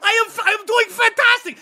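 very high-pitched vampire glee; acted giddy vampire; overexcited. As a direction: insist on it, bursting with excitement. Excited vampire answers his friends.

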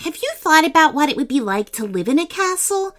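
put-on high, quick, smiling young heroine; big smile. A narrator's reading. Sophia wonders with her friend about castle life, big smile. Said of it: "Sophia said with a big smile".

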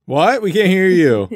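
calling up. Acted. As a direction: call up to someone far off. Crowd calls up to roof.

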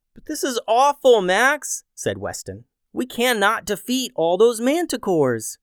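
put-on despairing boy. Weston despairing, voiced higher.

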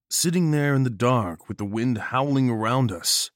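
usual speaking voice

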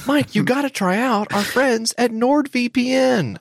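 high, slow, hammed-up pleading; mock letter voice. Host voicing his own letter to Mike, slow and high. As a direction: read it out like a desperate pen pal. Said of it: "I always make sure to tell Mike".

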